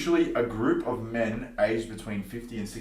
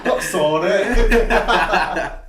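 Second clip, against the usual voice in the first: laughing; 7 semitones higher, 8 dB louder, much slower than usual. Real, not acted. Laughing repeat.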